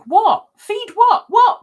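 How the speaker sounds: high loud puzzled what; hammed-up what, feed what; mock confusion. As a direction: play the baffled crowd. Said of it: "everyone else is like".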